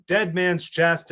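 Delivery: normal talking voice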